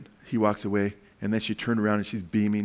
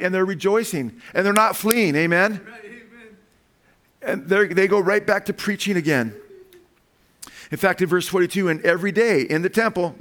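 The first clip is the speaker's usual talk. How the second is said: higher, louder preaching at full force; fervent preaching; 7 semitones higher, 4 dB louder than usual. Acted. Pastor to congregation, fervent preaching. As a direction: preach it out to the church.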